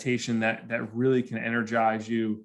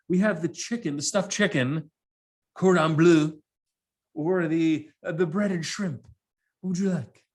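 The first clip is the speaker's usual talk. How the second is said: lightly voiced stewardess reciting a menu, higher; 4 semitones higher than usual. Stewardess offers passenger the dinner menu, polite higher voice. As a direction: imitate an attendant offering the choices. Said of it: "the stewardess is taking our dinner order".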